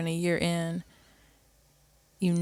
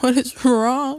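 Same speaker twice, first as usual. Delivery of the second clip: mock-worried, higher and louder; alarmed question; 7 semitones higher, 5 dB louder, much slower than usual. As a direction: play the startled husband asking what happened. Imitating husband finding her crying.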